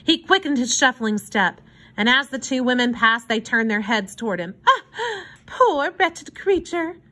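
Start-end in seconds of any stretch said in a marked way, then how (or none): 4.7-7.0 slow, high exclamation of pity; pitying fine lady. A narrator's reading. Woman in fur coat pities a hobo, exclaiming. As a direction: coo over him with drawn-out pity.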